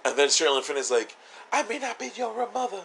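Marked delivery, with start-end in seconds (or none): 1.5-2.8 high, put-on motherly plea; mock pleading mother. Held-hostage mother professes love, mock pleading.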